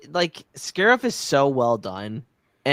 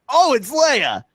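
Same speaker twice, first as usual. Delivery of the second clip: high, loud fan exclamation; excited recognition; 10 semitones higher, 5 dB louder than usual. Retold or imitated. Podcaster relives realizing it is Leia, excited outburst. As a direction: burst out as if spotting a favorite character. Acting out viewer's delighted recognition.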